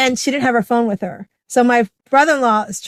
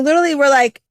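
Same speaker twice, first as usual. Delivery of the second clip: ordinary delivery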